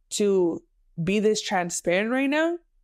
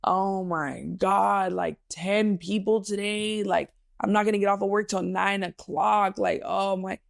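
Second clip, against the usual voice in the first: slow, drawn-out griping about the workload; mock moan of self-pity; ungrateful complaining; slower than usual. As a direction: drag the words out in weary complaint. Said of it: "translate into me being ungrateful".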